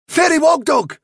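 high, loud cry of delighted hope; eager cry. A narrator's reading. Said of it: "he cried".

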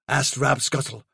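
usual speaking voice

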